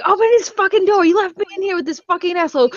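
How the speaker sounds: high, fast imitation of a frantic rant; mock panicked fury, pitched high; crazy fit. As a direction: act out a trapped woman losing it. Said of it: "having a fucking crazy fit".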